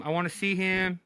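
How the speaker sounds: ordinary talk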